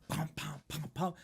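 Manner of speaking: soft breathy counting of pumps; mimed pumping. Retold or imitated. Narrator miming pumping the pellet gun, softly. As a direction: whisper each pump like working the gun.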